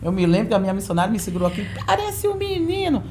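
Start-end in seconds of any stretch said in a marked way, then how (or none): 1.9-3.0 high, shocked church-lady voice; mock missionary scolding. Missionary grabbing the girl's short hair, high mocking tone. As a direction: squeak it out like a prim missionary.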